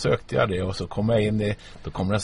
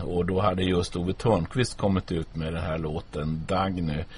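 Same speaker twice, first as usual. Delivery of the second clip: usual speaking voice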